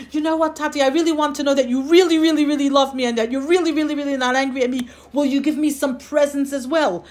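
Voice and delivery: high, loud, fast rush of pleading; wheedling child. Acted. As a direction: pester Daddy in one gushing run. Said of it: "he keeps on asking for more and more".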